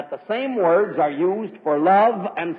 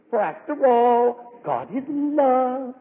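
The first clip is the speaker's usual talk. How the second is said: slow, drawn-out, higher-pitched cooing; syrupy mock piety; 6 semitones higher, much slower than usual. Modernist excusing everybody as brothers, syrupy mock piety. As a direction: stretch it out like a soppy modernist. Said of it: "Not in that tone of voice".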